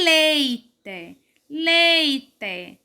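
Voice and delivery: "leite" very slow, first syllable LEI held long; stress on LEI. Shown as a demonstration. Teacher showing pupils the stressed syllable, LEI drawn out in "leite". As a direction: stretch and weight LEI in "leite". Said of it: "A sílaba mais forte é o lei".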